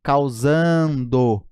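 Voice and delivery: "Causando" stretched very slowly so the "ndo" is clear; drawn-out demo. Shown as a demonstration. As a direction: pull "Causando" out slowly, syllable by syllable. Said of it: "N D O".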